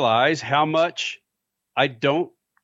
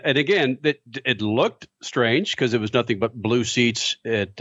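usual speaking voice

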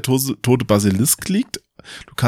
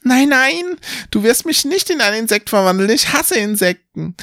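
angry scream; 13 semitones higher than usual. Acted. Halfling screams at the wizard in high-pitched fury. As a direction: shriek it in panic and rage. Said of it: "schreit mit wütender Stimme".

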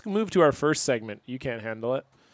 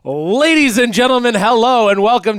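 loud, high podcast intro announcer; hype announcer; 5 semitones higher, 7 dB louder than usual. Acted. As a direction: boom it out like a show host. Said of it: "I wanna do the actual real good intro".

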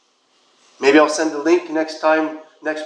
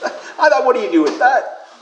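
talking through real laughter, higher; amused, pitched up with chuckles round it; laughing; 6 semitones higher, 4 dB louder than usual. Real, not acted. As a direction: laugh your way through the question. Laughs while retelling her reaction.

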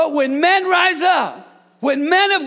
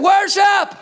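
higher, louder one-word cry "Worship"; shouted answer; 8 semitones higher, 4 dB louder than usual. Real, not acted. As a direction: fire it back at them. Pastor's one-word answer to complaints.

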